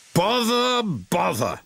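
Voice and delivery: first word pitched high, second dropping back; vexed muttered oath, starting high; exasperated. Acted. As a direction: exasperation that sinks on the repeat. Fat Controller's exasperation, car broken down.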